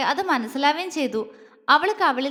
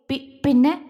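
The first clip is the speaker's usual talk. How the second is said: stammered, halting question; broken words; 4 dB louder than usual. A narrator's reading. Shocked husband asks his wife, faltering. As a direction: falter on the first syllable. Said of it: "അവന്റെ വാക്കുകൾ മുറിഞ്ഞു".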